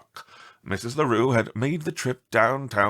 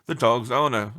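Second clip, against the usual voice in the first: ordinary delivery, nothing marked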